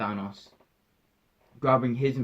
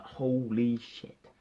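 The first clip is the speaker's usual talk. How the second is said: drawn-out slow holy shit; slow stunned holy shit; slow awe; much slower than usual. Retold or imitated. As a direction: stretch it out, stunned. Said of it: "I'm there like".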